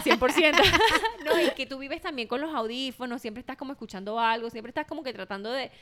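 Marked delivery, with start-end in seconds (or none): 0.0-0.6 a loud, high, joking "cien por ciento"; exaggerated emphasis. Real, not acted. Teasing her friend, loud and high. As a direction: oversell it with a laugh. Joking about being balanced.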